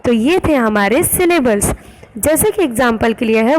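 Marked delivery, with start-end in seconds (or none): none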